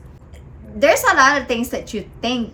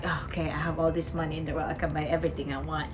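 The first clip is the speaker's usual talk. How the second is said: careless rich person; 4 semitones lower, 10 dB quieter, much faster than usual. Retold or imitated. Imagined rich wrongdoer's voice.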